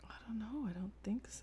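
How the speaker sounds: soft unvoiced whisper. Real, not acted. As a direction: breathe the words, barely voiced. Whispered tired reply.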